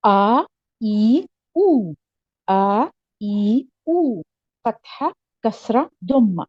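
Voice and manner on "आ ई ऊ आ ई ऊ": long "आ", "ई", "ऊ", each held; sounding out vowels. Shown as a demonstration. Teacher drilling vowels with the class. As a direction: sing out "आ", "ई", "ऊ" clearly. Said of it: "vowel drill".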